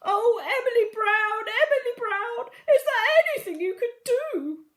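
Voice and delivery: high, loud whiny sobbing plea; silly queen. A narrator's reading. Silly queen begging Emily Brown for help, high and whiny. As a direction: beg like a spoilt crying queen. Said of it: "asked the silly, naughty queen".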